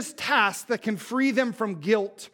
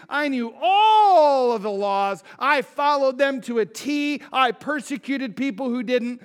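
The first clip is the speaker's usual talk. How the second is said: passionate testimony; 5 semitones higher, 6 dB louder than usual. Retold or imitated. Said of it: "He's like".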